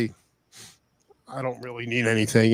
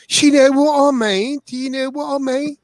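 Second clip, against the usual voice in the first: mock British accent; 12 semitones higher, 4 dB louder than usual. To his stream friends, shedding his American accent, mock British accent. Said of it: "shed my American accent".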